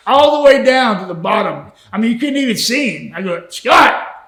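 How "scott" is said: calling out. Retold or imitated. Calling down the ravine.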